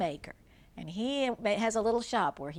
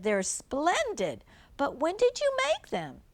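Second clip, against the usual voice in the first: surprised wife; 9 semitones higher, 4 dB louder than usual. Acted. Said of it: "she said".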